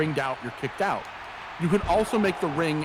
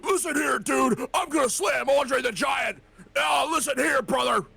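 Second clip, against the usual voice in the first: Hulk Hogan shout; 10 semitones higher than usual. Retold or imitated. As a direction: yell it like a hyped-up wrestler cutting a promo. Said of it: "So I put in, like".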